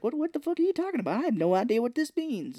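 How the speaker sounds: high, fast indignant protest; baffled outrage. Retold or imitated. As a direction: react like you've been accused out of nowhere. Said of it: "he's like".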